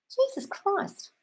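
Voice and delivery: high, slow, stretched-out Jesus Christ in disbelief; exasperated drawn-out groan. Real, not acted. Host reading a viewer comment, exasperated and high. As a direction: drag Jesus Christ out long and high, appalled.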